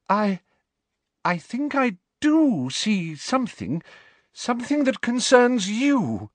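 high, slow mystic voice, angry; spooky seer straining with anger; ethereal voice. Acted. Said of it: "attempt at her usual ethereal voice ... shaking with anger".

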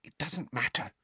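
soft, hushed reassurance; half whisper, calming a friend. A narrator's reading. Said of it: "Hermione whispered".

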